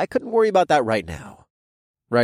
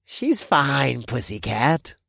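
taunting; much slower than usual. Acted. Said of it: "a man's voice taunted".

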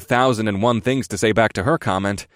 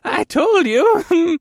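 put-on high, loud, drawn-out old man cackling; gleeful old quartermaster laughing; between laughs; 6 dB louder, much slower than usual. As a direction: laugh your way through the words. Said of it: "he said between laughs".

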